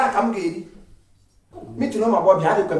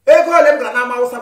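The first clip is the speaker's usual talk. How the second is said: much louder complaint; angry shout; 11 dB louder than usual. Acted. Man shouts about money spent at the market. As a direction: throw the grievance loudly.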